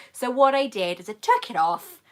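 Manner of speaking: normal talking voice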